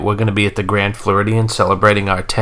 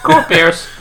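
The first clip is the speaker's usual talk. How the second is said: put-on Minnesota accent; 6 semitones higher than usual. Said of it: "He just said it in his Minnesota accent".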